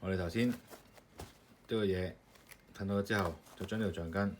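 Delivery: normal talking voice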